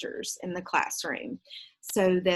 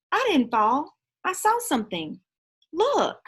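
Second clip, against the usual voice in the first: put-on higher and rising, a girl spotting something; excited girl; 9 semitones higher, 5 dB louder than usual. Janet spots the pen, excited and higher. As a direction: point it out with a sudden thrill. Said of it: "But Janet said".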